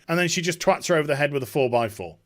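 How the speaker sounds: ordinary talk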